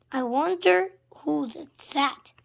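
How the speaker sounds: mock wondering. A narrator's reading. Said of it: "asked another fan".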